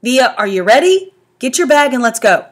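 calling out. A narrator's reading. Dad calling daughter.

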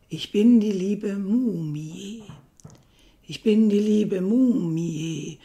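measured verse with stretched, emphatic words; poem recitation. Acted. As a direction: recite it as a playfully spooky mummy, swelling to a loud warning.

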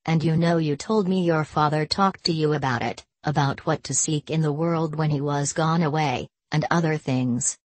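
ordinary delivery, nothing marked

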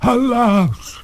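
high ghostly lament; crying out. A narrator's reading. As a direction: wail it as a despairing lady. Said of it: "cried the ghost".

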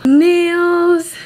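conceited. Pretend. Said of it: "I feel conceited".

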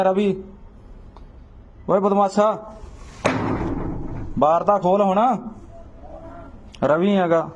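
calling out. Real, not acted. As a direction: shout for him to come out. Calling someone out of house.

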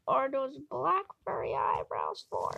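usual speaking voice

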